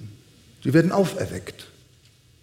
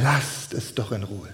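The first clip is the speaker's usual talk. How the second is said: low, soft appeal; hushed plea; 8 semitones lower, 7 dB quieter than usual. Acted. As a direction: plead quietly, voice dropped. Pleading on behalf of Israel.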